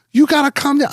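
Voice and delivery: high-pitched imitation of a worried mother; imitated mother. Mother telling her angry son to calm down, high imitated voice. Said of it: "My mother's like".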